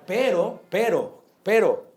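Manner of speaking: preacher building a loud warning. Acted. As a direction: ramp up the warning. Repeated building interjection.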